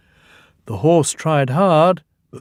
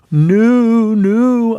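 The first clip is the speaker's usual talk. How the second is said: raised, louder refusal; playful Toby; 9 semitones higher, 7 dB louder than usual. A narrator's reading. Toby answering the ghost in the nursery rhyme. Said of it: "answered Toby".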